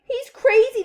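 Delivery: very high, loud cry; frightened shouting. A narrator's reading. Parents joining a chorus about Wonka, shouting. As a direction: yell it in panic. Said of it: "they shouted".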